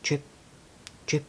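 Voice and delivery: ordinary delivery, nothing marked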